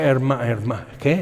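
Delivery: normal talking voice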